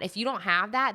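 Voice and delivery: usual speaking voice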